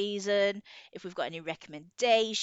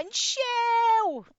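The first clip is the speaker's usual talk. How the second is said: Shell pitched up high and loud; high sing-song intro; 7 dB louder than usual. Acted. Co-host repeats the name as a cheery sign-on. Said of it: "We've really gotta work on that".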